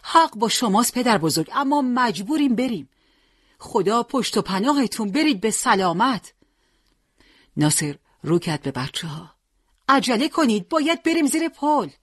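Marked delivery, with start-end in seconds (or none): 0.0-6.3 pleading, emotional higher voice; pained, raised-pitch parting; tearful farewell. A narrator's reading. 9.9-11.9 high-pitched cry to hurry; rally the men to run for cover; pressing, raised-voice order; urgent command. A narrator's reading. Urging the men under the bridge.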